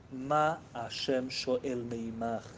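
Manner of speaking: usual speaking voice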